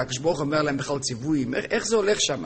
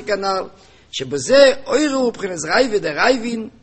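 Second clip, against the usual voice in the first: raised, sing-song study-text reading; reading text aloud; 6 semitones higher, 6 dB louder than usual. Acted. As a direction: recite the text in rising and falling phrases. Reads the discourse text aloud.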